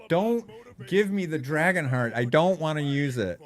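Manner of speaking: high, loud whiny begging; loud mock plea. Real, not acted. Streamer pleading loudly with the game not to give the item.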